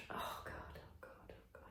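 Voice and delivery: whispered despairing oh God; exasperated whisper. Real, not acted. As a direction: breathe it out in dismay. Reaction to crude story.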